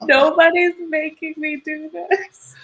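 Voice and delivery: high, slow mock-mortified protest; retold embarrassed whine. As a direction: draw it out high, imitating your mortified self. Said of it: "like, mortified".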